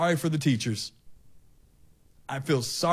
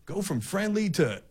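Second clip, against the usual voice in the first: ordinary talk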